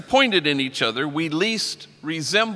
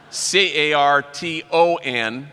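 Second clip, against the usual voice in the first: slow letter-by-letter spelling; spelled out slowly; 3 dB louder, slower than usual. Shown as a demonstration. Spelling the tag for the audience, slow and deliberate. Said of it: "which means cardboard in Spanish".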